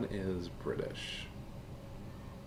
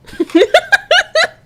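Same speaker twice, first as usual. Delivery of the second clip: shrieking laugh; 18 dB louder than usual. Real, not acted. Laughing after own impression.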